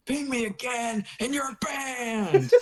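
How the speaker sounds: high, drawn-out warning; hammed-up exasperated ultimatum; mock threat. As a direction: stretch the threat out high, half laughing. Acting out Thomas's threat.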